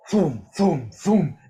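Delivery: mock thuds, thum thum thum; booming footstep noises. GM imitates a giant's heavy steps, booming thuds. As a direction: beat out heavy footfalls with your voice.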